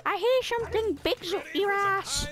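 hammed-up game character voice; mocking imitation. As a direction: parrot the character in a silly put-on voice. Mimics game character's greeting line.

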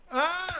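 sharp high cry of pain; sudden pained cry; pained yelp. Acted. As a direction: yelp as the head hits. Hurt, hitting head.

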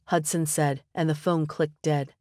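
ordinary delivery, nothing marked

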